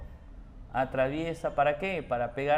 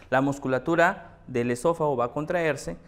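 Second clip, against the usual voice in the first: ordinary delivery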